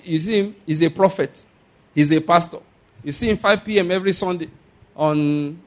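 usual speaking voice

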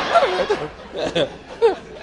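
high, delighted cackling laugh; a worker cackles with joy. Retold or imitated. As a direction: let out a gleeful laugh. Worker laughs at dollar offer.

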